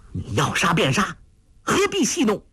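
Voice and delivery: rising furious cry, pitch jumping high; angry shout. Acted. Mu Nianci shouting at Huang Rong in fury. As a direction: spit it out in outrage. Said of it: "大怒喝道".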